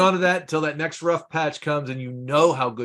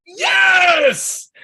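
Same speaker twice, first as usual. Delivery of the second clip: a loud high whoop of triumph; cheering yes; 7 dB louder than usual. Real, not acted. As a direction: shout it like a victory. Husband cheers at no Pepsi.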